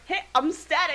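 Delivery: sarcastic hero intro. Retold or imitated. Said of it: "Sarcastic ass, snarky ass motherfucker Static Shock comes in and is just like".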